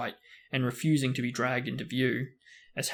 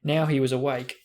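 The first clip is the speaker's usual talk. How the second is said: ordinary delivery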